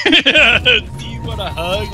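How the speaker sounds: slow, syrupy mock sympathy; slow, high mock-tender offer of a hug. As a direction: coo it like you're pitying him, hammed up. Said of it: "I was like".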